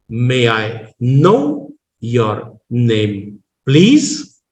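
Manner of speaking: teacherly, drawn-out rises on "know" and "please"; exaggerated tone variation. Shown as a demonstration. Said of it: "a rise of the tone".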